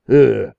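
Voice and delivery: loud, high grunt of revulsion; disgusted ugh. Acted. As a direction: spit out the disgust. Disgusted parson ends tirade.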